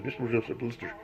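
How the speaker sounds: put-on soft, mumbled little boy; boy mumbling to the pet store owner. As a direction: mumble it under your breath.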